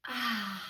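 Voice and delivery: soft, low breathed-out "ah"; calming exhale. Shown as a demonstration. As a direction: let the breath go gently. Models breathing out in story.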